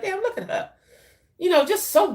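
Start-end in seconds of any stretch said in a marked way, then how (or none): 0.0-0.7 much higher, softer awed aside; mock stunned admiration under the breath; a playful gasp of being impressed. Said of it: "I was like".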